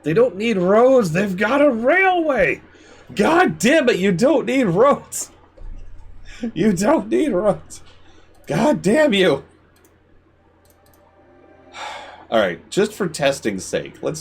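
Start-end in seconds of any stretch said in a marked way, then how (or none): 0.0-9.4 high-pitched furious shouting; furious rant. Real, not acted. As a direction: rage at the stubborn residents. Raging at residents.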